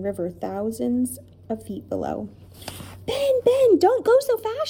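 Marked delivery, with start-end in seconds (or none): 3.1-4.7 very high, loud plea; frightened cry. A narrator's reading. Lindy to Ben on the swaying bridge, crying out. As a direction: cry after him in alarm. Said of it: "she cried".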